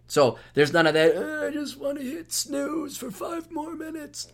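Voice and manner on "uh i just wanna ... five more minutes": high, soft, slow groggy whine; mocking sleepy snoozer. Host mimicking people begging for the snooze button, groggy whine. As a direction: moan it slowly, half asleep and high. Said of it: "I'm not there making fun of you".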